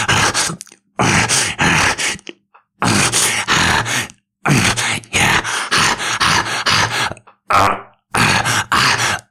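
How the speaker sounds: short moans rising and falling in pitch; climax moans. Acted. Man moaning to partner at climax, rising moans. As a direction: let out climax moans between breaths.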